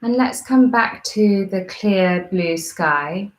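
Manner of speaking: calm, measured meditation guide with long pauses; guided meditation. Real, not acted.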